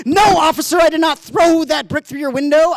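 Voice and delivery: loud, high denial to an officer; mock-outraged shout of denial; indignant protest. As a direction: protest your innocence at full volume.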